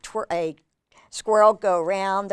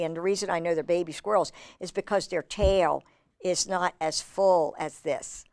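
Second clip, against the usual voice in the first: ordinary delivery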